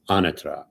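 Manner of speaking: Anatra stressed on the first syllable, AH-nuh-truh; Italian pronunciation. Shown as a demonstration. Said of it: "in Italian, it's".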